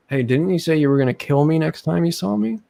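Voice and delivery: acting out a loud challenge; retold confrontation. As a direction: call him out, rising to the end. Acting out his confrontation in line.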